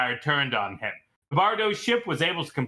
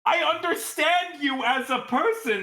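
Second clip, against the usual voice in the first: indignant Cecil; 8 semitones higher than usual. Acted. NPC insists, much higher.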